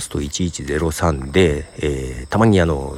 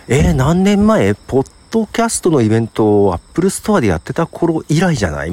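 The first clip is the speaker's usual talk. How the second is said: louder, higher mock amazement at the years passed; acted surprise; 9 semitones higher, 3 dB louder than usual. Podcaster recalling a reunion with an old acquaintance, acted surprise.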